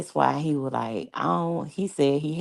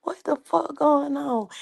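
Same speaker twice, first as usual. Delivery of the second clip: high mock baffled voice; Tim confused; 11 semitones higher than usual. Tim baffled at the strange men arriving, high mock voice. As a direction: play Tim lost and baffled, pitched up. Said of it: "Tim was looking like".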